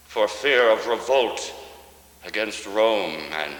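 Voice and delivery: ordinary talk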